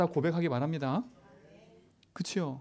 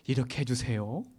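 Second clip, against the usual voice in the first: courteous, lower-pitched mock email reading; polite email; 5 semitones lower than usual. Pastor quoting his own emails to a colleague, politely. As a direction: recite your own polite request. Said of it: "공손히".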